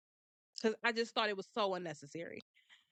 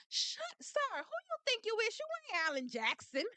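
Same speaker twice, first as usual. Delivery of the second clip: mock telling-off; 10 semitones higher than usual. Telling off the lawyer.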